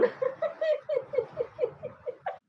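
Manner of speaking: high-pitched giggling laugh; a long run of high little giggles. Real, not acted. Streamer amused while chatting to viewers, high giggly laugh.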